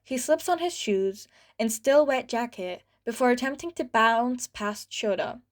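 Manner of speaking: ordinary delivery, nothing marked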